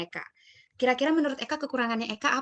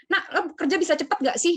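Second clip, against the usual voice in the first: higher-pitched nagging question; mock impatient friend; 6 semitones higher, 3 dB louder than usual. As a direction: imitate a friend chiding, pitched up. Retold friend criticising her speed.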